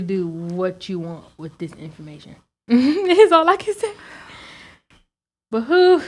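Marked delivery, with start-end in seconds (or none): 2.7-6.0 laughing tease. Real, not acted. Teasing sign-off.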